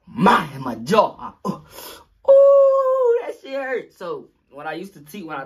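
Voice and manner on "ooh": high loud yelp of pain; sharp pained ooh; pain cry. Retold or imitated. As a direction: cry out from a hit. Hit in the jaw.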